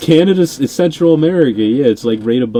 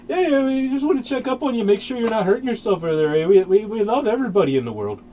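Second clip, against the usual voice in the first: higher, overly friendly mock Canadian voice; mock sweet Canadian; 5 semitones higher, 4 dB quieter, faster than usual. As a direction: play nice Canada checking in, eh. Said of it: "it's just like".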